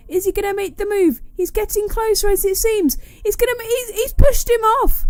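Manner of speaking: building to a high, loud peak; excited commentary. Real, not acted. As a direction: ramp up as he gets pushed off. Race commentary, move blocked.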